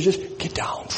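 urgent unvoiced whisper. Father tells the children to get down, whispered. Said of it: "So he said".

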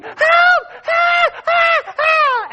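high, loud panicked cries of help; frightened relative crying for help, high and loud; retold screams for help. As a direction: scream for help like you're stuck on the cable car. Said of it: "she screamed".

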